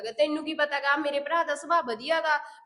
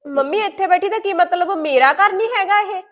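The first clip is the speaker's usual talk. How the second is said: louder, higher wounded protest; hurt daughter yelling at mom; offended shout; 5 semitones higher, 6 dB louder than usual. Acted. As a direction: hit back, stung and angry.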